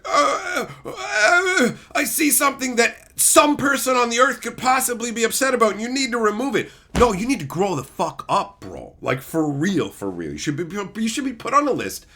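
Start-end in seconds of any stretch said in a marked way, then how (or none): 0.1-6.7 high whiny mock voice; mock crybaby. Mocking an easily upset fan, whiny. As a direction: whimper like an offended crybaby. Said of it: "crybaby bitches will just be like".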